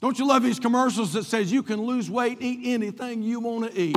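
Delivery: ordinary talk, nothing marked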